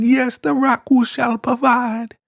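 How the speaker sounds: very high, slow mock chant; zealous follower. Follower echoing the leader's proclamation, very high chanting voice. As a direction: echo it in a squeaky-high drawn-out voice.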